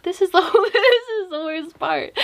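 cringing, amused confession; embarrassed laughter. Real, not acted. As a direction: laugh at your own embarrassing story.

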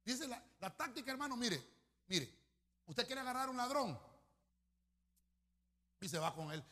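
ordinary delivery

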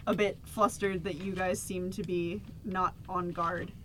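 ordinary talk

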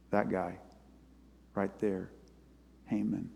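name him softly as the culprit at the table; quiet accusation. Retold or imitated. Esther accuses Haman at dinner.

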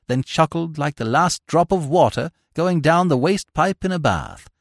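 ordinary delivery

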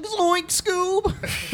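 high, strained cartoon stoner voice; Shaggy voice. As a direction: squeak it out like Shaggy. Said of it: "with this impression".

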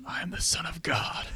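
hushed, unvoiced whisper close to the ear; creepy ear whisper. Handheld console whispers to its owner at night, creepy whisper. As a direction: whisper it right into their ear. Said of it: "gets real close to your ear and whispers".

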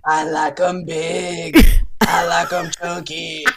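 slow, lazy crooning Moto Moto voice; Moto Moto impression. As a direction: drawl it out slow like the smooth hippo. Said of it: "Moto, Moto likes you".

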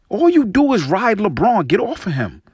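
high, hard accusation; indignant. Real, not acted. Host accusing the commentator, indignant. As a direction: jab each word at him.

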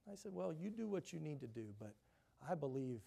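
ordinary talk, nothing marked

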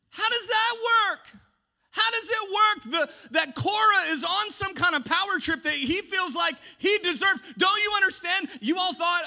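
loud, high pulpit shout; preaching shout. Acted. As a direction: shout the question at the crowd.

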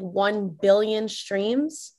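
ordinary delivery

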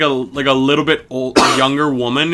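normal talking voice

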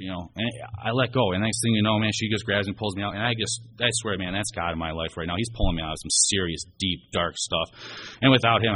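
normal talking voice

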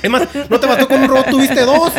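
mocking mom.

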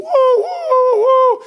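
high, loud "woo" like a steam whistle; train whistle imitation. Preacher joking about the cooker train, imitated whistle hoots. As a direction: hoot like a toy steam train. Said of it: "so he can go".

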